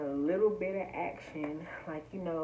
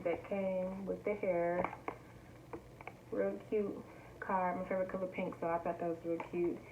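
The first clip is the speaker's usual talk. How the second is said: usual speaking voice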